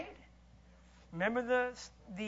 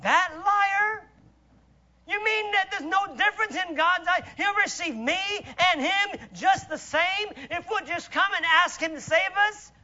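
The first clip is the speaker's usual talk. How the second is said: indignant disbelief, pitched up; incredulous questions; 6 semitones higher, 6 dB louder than usual. Retold or imitated. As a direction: ask it as if outraged at the idea. Quoting an objector's incredulous questions.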